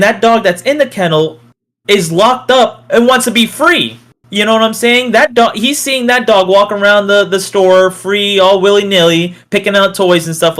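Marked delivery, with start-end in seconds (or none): none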